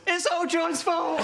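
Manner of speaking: high, slow, mock sobbing blame; mock crying wail. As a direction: wail it like a crying teenage boy. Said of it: "starts crying".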